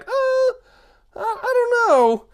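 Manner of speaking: very high, hesitant squeak; doubtful group. Acted. The group doubting the smart guy, squeaky hesitation. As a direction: stall doubtfully in a tiny high voice. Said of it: "And we're like".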